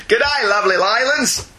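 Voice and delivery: put-on G'day said as gudday in a light Australian accent; hammed-up Aussie greeting; mock Australian accent. Host reading an Australian listener's email in mock Australian accent. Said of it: "Don't take offence at the accent".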